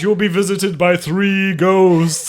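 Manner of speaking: slow, a touch of ghostly narrator; lightly haunting ghost announcement. Retold or imitated. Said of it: "ghostly voice".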